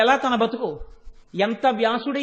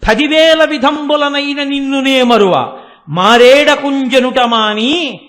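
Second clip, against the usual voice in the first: loud, slow, sustained recitation; loud verse promise; 2 semitones higher, 8 dB louder, slower than usual. Retold or imitated. Goddess promising never to forget Vyasa, loud drawn-out verse. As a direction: proclaim the verse, stretching every phrase.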